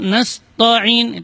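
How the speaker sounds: "nasta'in" said as "nasto'in"; "nasta'in" with dark, rounded "to"; thickened ta mistake. Shown as a demonstration. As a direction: make the ta of "nasta'in" a heavy "to". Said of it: "itu salah".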